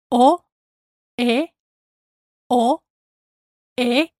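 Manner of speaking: O and E said as pure short vowels, alternating; Spanish vowels O E. Shown as a demonstration. Teacher drilling the vowels of llover. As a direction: keep each vowel clean and unglided. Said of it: "Watch out for the vowels".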